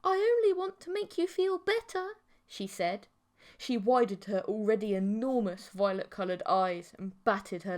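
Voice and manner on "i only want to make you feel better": very high, loud sugary voice; eerie sweet creature. A narrator's reading. Mantis girl exposes his lonely posts, high and sugary. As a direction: press him with creepy sweetness.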